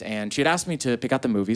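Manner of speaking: ordinary delivery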